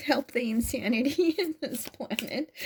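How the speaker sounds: laughing through the words, voice lifted; high, emotional laugh breaking into the words; tearful laughing. Real, not acted. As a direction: laugh while close to tears. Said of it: "I'm laughing, but I'm not laughing".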